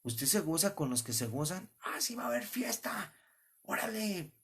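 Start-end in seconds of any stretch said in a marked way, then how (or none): 1.8-4.3 mock party-goer. Mocking a party-goer's reply.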